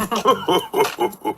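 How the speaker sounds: high piglet giggle. A narrator's reading. Piglet giggling at the big bed, high soft giggle. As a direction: giggle softly with delight.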